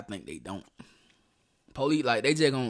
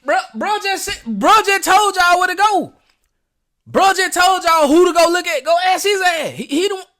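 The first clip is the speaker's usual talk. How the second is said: shouting, much higher and louder; loud excited shouting; 14 dB louder than usual. Real, not acted. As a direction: yell it at the screen in disbelief. Reacting loudly to the clip.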